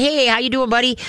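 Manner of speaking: high, happy greeting to a dog; happy dog talk. Shown as a demonstration. Trainer greets an apprehensive dog, talking happy. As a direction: greet the dog in a lifted, friendly voice. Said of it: "talking happy to him".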